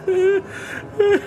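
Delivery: broken laugh. A narrator's reading. Trapped Fortunato laughs.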